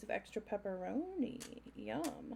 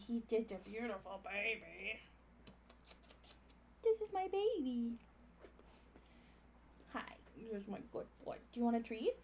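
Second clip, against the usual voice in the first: talking to a dog; 5 dB quieter than usual. Real, not acted. Doting on dog.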